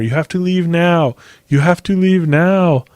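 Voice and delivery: high, evenly repeated order; stern worker. Retold or imitated. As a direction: imitate an employee standing firm.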